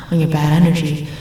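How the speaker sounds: usual speaking voice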